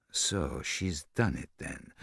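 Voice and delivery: soft, slow repetition of Martin's words; quiet musing repeat. A narrator's reading. Poirot repeats Martin's phrase, quietly pleased. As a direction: savour it quietly.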